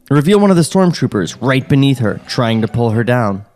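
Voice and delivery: normal talking voice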